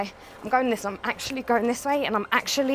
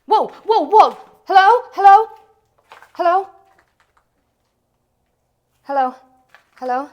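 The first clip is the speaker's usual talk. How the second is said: loud, high, alarmed calls; startled calling out; 5 semitones higher, 12 dB louder than usual. Real, not acted. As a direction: call into the house in sudden fright. Startled, calls out to unseen presence.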